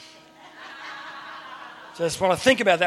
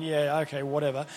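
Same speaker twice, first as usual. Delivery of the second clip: low, soft, offhand brush-off; mock dismissive; 6 semitones lower, 7 dB quieter than usual. Abram humouring God's promise, low dismissive mumble. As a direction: toss it away under your voice.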